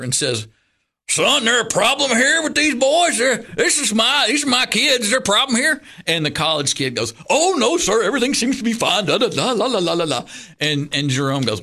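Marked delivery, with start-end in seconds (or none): 1.1-5.8 much higher, louder protective pastor voice; mock authority, pitched up and louder; stern pastor. Jerome confronting the counselor. 7.3-10.2 much higher, fast, nervous yes-sir voice; mock sheepish kid trailing off into la la; flustered counselor. Counselor backing down to pastor.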